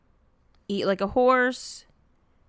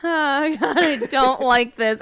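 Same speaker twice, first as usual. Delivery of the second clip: disgusted laugh; 5 semitones higher, 5 dB louder, slower than usual. Real, not acted.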